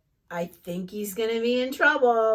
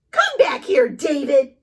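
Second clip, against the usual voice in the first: mock yelling mom; 8 dB louder than usual. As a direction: shout after a runaway child. Mom chasing in story.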